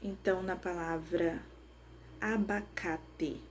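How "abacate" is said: "abacate" slowly split into a-ba-ca-te; syllable by syllable. Shown as a demonstration. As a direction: say "abacate" in slow, separate syllables. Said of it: "bater palmas pra conseguir identificar as sílabas". ("abacate" sílaba por sílaba, devagar)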